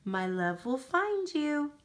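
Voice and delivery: plain reading voice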